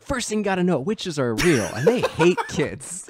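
big, engaged mock-narrator delivery; big energy. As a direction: punch it up like an over-eager narrator, drawing out the end. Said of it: "like, big energy".

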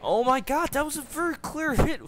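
very high, loud protest; outraged yell. Real, not acted. Player says that was a clear hit, high outraged yell. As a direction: cry out in high-pitched outrage.

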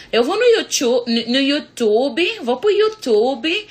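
mocking Brazilian Portuguese, slow and stretched; Brazilian accent. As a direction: drag it out like a Brazilian. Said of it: "brasileiro fala".

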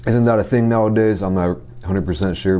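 talking between bites; chewing while talking. Real, not acted. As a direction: mouth partly full of rice. Seen 0: Took a forkful at -3; mouth still working food while talking.

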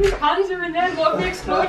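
high fretting voice of a friend; mock worried friend. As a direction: play up his panic. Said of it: "He was like".

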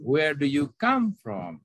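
wh-question sinking at the end; falling question tune. Shown as a demonstration. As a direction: lift on "come" and fall away on "from". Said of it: "turun yang di akhirnya".

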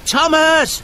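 high, loud warning call; shout. A narrator's reading. Jack warns Thomas of the crumbling bridge, loud shout. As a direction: shout to stop him from danger. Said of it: "he shouted".

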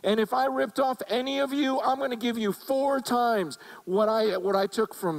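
high, excited promise to the crowd; eager Zacchaeus. Retold or imitated. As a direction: announce it proudly to everyone. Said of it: "he goes".

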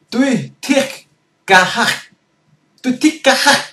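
high, loud, throaty Middle Eastern voice; Middle Eastern accent. Shown as a demonstration. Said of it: "Giọng này là giọng Trung Đông". (giọng Trung Đông cao và to, đầy chất cổ họng)

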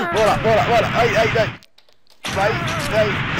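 high, loud cheering on; excited urging. Real, not acted. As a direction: push the character on, loud. Urging character on.

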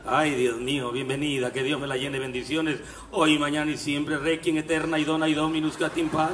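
mock funeral prayer for the treasurer; mock prayer leader. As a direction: pray over her like a funeral rezandero. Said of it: "soy rezandero".

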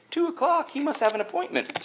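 lightly hammed-up high complaint; mock complaining churchgoers. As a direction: imitate grumbling people in a small high voice. Mimics disappointed people.

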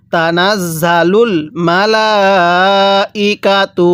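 slow recitation holding the লা of মালাইকাতু for four alifs; four alif stretch. Shown as a demonstration. Teacher recites the verse slowly with the long four-alif madd. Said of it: "এই লামের মধ্যে কিন্তু আমরা চার আলিফ টান দিব".

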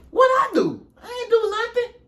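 high, whiny innocence; innocent protest. Retold or imitated. Accused friend denying anything, high and whiny. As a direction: plead innocence in a squeaky voice.

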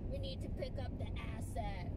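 lightly voiced secret agent; a hint of a spy movie agent; spy movie line. Wife joking on a car trip, light mock spy voice. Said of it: "like in, like, a Mission Impossible movie".

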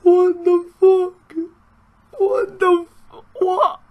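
high, squeaky laughing wail; hysterical disbelief. Real, not acted. Gamer baffled by the keeper, high hysterical disbelief. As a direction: squeeze the words out through helpless laughter. Helpless disbelief at own fluke goal.

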